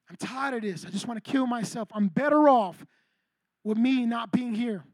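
low, subdued, worn-out voice; acted despair. Preacher voicing a teen speaking death over themselves, subdued despair. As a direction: drop low and heavy, as if giving up.

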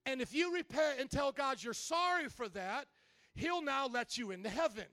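lightly voiced daughter appealing to mom; earnest daughter. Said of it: "and her daughter says".

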